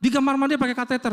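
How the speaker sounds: high-pitched mock telling-off, held up all through; retold scolding. Staff to patient leaving his bed, retold scolding. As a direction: imitate hospital staff ordering a patient about. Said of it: "saya dimarah-marahin".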